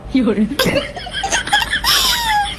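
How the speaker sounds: high-pitched shy giggle. Acted. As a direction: giggle at the awkward moment. Wife laughs at brother-in-law's mistake. (ऊँची खिलखिलाती हँसी)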